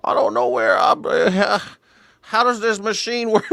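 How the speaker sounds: put-on high, slow, quavering old man; old Uber driver. Comedian imitating elderly Uber driver to passenger, high old voice. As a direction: ask like a lost elderly driver. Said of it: "He's like".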